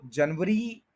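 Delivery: जनवरी with a v, jan-va-ree; local pronunciation. Shown as a demonstration. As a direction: say जनवरी in three parts, the local way. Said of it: "इस तरह से नहीं है". (जनवरी, देसी अंदाज़ में)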